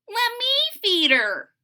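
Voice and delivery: high, slow, pleading; eager child. A narrator's reading. Froggy asks mom to feed the baby, high and eager. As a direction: beg to feed her. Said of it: "said Froggy".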